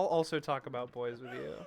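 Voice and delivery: ordinary talk, nothing marked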